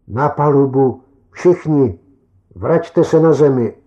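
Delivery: loud shouted order; roaring. A narrator's reading. Captain roars at the crew to head home, loud command. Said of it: "řvu".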